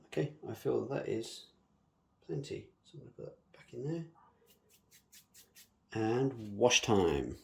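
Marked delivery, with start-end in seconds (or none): 5.9-7.4 drawn out slowly. Real, not acted. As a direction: stretch it out lazily. Announcing next step.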